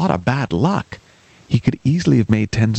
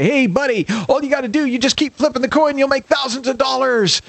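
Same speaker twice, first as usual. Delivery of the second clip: cheerful encourager; 14 semitones higher than usual. Acted. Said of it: "I'm gonna say".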